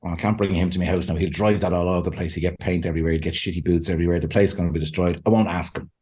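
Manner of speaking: low, fast fretting voice, muttered to himself; a low, hurried worried-host voice; mock fussy host. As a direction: grumble the worries low and quick. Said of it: "And I was like".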